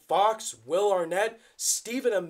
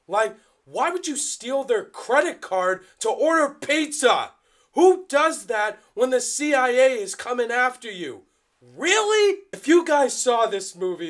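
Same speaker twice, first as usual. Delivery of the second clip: frustrated rant; 7 semitones higher, 8 dB louder than usual. Real, not acted. Ranting about an annoying character.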